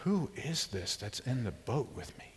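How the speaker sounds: soft, slow, awestruck question; awed hush. Pretend. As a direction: breathe it in wonder. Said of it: "they're asking a new question".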